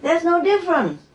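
high, scolding challenge; mild mock scolding of the master; lightly voiced lecturing retort; telling him off. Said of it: "I said".

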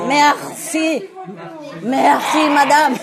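MERCI with a heavy throaty R before "si"; French R merci. Shown as a demonstration. Modelling thanks for learners, exaggerated French merci. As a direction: rasp the R in merci, then madame. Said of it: "with the R".

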